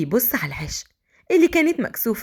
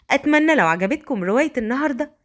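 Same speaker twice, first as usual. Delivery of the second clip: ordinary delivery, nothing marked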